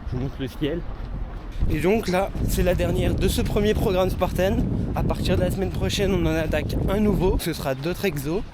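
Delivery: talking while out jogging; while running. Real, not acted. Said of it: "on est en train de courir nos dix minutes".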